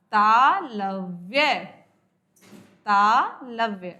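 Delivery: तालव्य said very slowly, syllable by syllable; slow dictation. Shown as a demonstration. Teacher dictating the term to students, very slow. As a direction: spell out तालव्य slowly for note-taking. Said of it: "कंठ".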